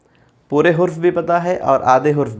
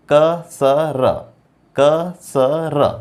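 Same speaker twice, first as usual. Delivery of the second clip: each syllable drawn out, very slowly; slow syllable demo; much slower than usual. Shown as a demonstration. Teacher spelling out Arabic syllables for students, very slow. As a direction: sound it out slowly for a beginner. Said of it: "तो ये है ज़बर की मिसाल".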